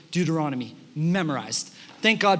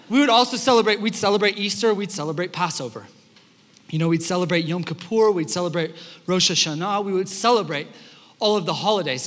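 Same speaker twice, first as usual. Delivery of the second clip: ordinary talk, nothing marked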